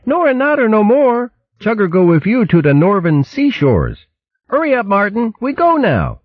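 tiny squirrel lisping proudly; baby squirrel voice. A narrator's reading.

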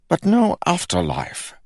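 high-pitched, aggrieved grumble; grumbling king. A narrator's reading. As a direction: protest as a dismayed king. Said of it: "grumbled the king".

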